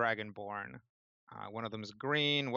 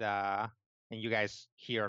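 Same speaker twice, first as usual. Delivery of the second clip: ordinary delivery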